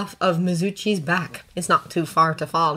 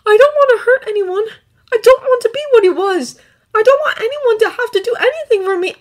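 high, loud, upset outpouring; distraught pleading; 7 dB louder than usual. A narrator's reading. Exhausted boy begging the dragons to let him go, distraught. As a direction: plead to be released, voice pushed up high.

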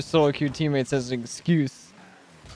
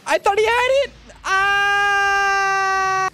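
anguished shout; 13 semitones higher, much slower than usual. Real, not acted. Gamer crying out at a missed save, high shout. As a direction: cry out high. Near-miss in the game.

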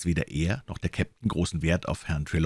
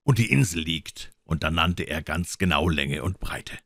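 ordinary delivery, nothing marked

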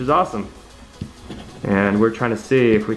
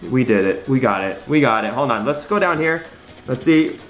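ordinary talk

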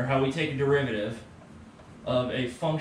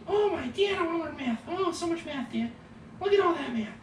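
very high squeaky excited cat; eager cat voice. Pretend. Teacher voices his cat excited to learn math, very high. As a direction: make the cat gush about math. Voicing the cat's reply.

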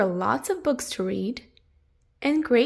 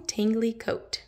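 ordinary delivery

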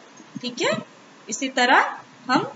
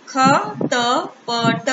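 very slow, each syllable of खटपट pulled apart as ख-ट-प-ट; split into syllables; 3 dB louder, much slower than usual. Shown as a demonstration. Teacher sounding out खटपट slowly for children. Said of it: "अब एक साथ मिला के पढ़ते हैं".

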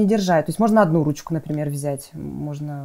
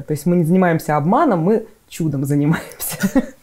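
usual speaking voice

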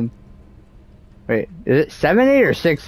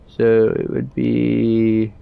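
usual speaking voice

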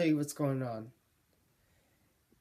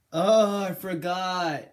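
sudden realization; 4 semitones higher, 7 dB louder, slower than usual. Real, not acted. Suddenly remembers something.

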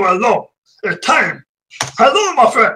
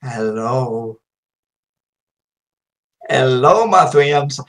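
deep voice; 12 semitones lower, much slower than usual. Acted. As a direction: drop to a deep rumble. Sudden low greeting.

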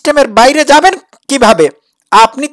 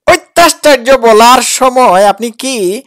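ordinary talk, nothing marked

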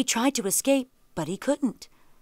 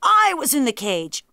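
loud stress on "I"; interrupting correction; 6 semitones higher, 6 dB louder than usual. A narrator's reading. Tiger corrects the jackal, "I" stressed loudly. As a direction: hit "I" high and hard. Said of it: "interrupted the tiger".

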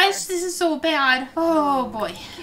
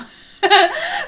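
loud high laugh bursting out; burst of laughter; 13 semitones higher, 8 dB louder than usual. Real, not acted. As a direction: crack up at the screen. Laughing at sims' joke.

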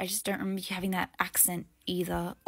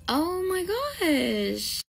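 high, slow drawn-out oh my gosh; delighted gasp; 8 semitones higher, much slower than usual. Acted. Old coworker thrilled to see her friend again. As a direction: gasp with joy. Overjoyed recognition.

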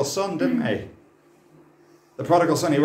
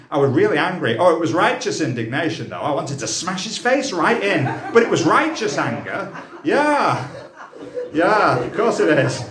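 louder, laughing as he justifies his anger; 4 semitones higher, 5 dB louder than usual. Retold or imitated. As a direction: ham up a believer calling rage righteous. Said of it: "oh, that's what Christians do".

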